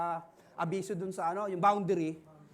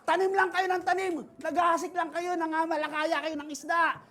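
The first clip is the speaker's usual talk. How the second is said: loud, high, fervent preaching; 8 semitones higher, 6 dB louder than usual. Acted. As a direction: rouse the congregation to sow and fish. Preaching at full force. (malakas na pangangaral)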